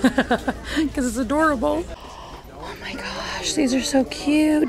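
high, hushed delight, slowed; gushing. Real, not acted. Shopper cooing at shot glasses, high and hushed. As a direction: coo over something adorable.

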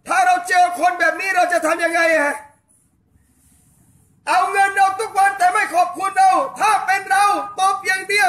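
fervent shouted sermon, hammering each question; preaching at full force. Acted. Preacher rousing the island congregation, fervent shouting sermon.

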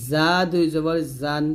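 slow z in জা and জান, no j; zā sound. Shown as a demonstration. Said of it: "জা জিহ্বাটা নিচে দাঁতে লাগাতে হবে". (জা জিহ্বা নিচের দাঁতে লাগিয়ে)

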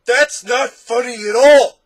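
very loud, high, drawn-out wail; sobbing wail. A narrator's reading. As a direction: bawl it out like a hurt monster. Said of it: "sobbed the Din".

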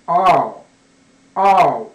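あー as one long, loud English R; original R sound. Shown as a demonstration. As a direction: hold あー long with the tongue touching nothing. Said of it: "Rってもともとは".

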